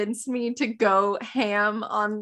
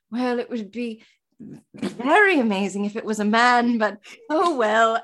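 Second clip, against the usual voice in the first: put-on British accent. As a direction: play a hesitant Brit in a rough British accent. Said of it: "my British accent is crap now".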